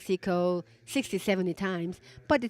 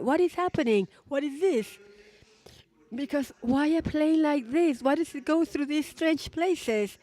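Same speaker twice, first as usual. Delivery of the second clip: raised, wondering voice of the group; puzzled speculation; 7 semitones higher than usual. Retold or imitated. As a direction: ask it like a puzzled onlooker.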